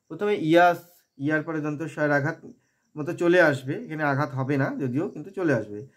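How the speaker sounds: ordinary talk, nothing marked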